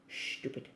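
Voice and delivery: stupid as shtupid, whispered; stupid with the shh sound, whispered; English stupid with an H. Shown as a demonstration. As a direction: breathe out a soft English shtupid. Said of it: "in England I like it with the H in it".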